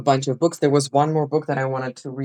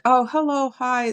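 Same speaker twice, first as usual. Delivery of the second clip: mock cheery greeting; 11 semitones higher, slower than usual. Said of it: "we're not like".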